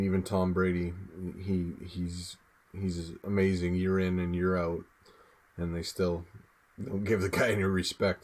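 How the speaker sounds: usual speaking voice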